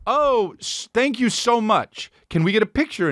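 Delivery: overly sweet starstruck thank you; gushing thanks. Retold or imitated. Fan thanking Princess Peach for the photo, gushing high. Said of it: "I said".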